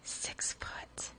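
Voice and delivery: hushed whisper. Real, not acted. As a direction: breathe it out in a whisper. Repeats height in hushed tone.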